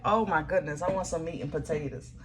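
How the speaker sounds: normal talking voice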